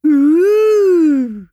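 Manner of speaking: high, loud, mocking swoon; shrill hammed-up sarcastic ooh. A narrator's reading. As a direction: camp it up with a teasing falsetto coo. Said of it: "he said sarcastically".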